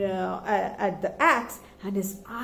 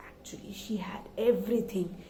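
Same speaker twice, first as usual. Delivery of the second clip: hushed, unvoiced storyteller's secret; whispered aside; 3 semitones lower, 8 dB quieter, faster than usual. A narrator's reading. Storyteller whispers the granny's secret to listeners. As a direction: let the children in on it in a whisper.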